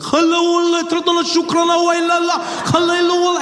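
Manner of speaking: high-pitched mock-Arabic rant on one sustained note; ranting mock-Arabic outburst. Bearded man suddenly going off on the plane, ranting in mock Arabic. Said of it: "he just went off".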